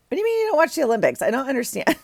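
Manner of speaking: high, fast mock disbelief, half laughing; incredulous. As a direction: act baffled they skip the Olympics, high and quick.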